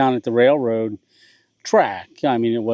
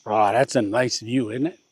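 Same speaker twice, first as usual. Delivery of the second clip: normal talking voice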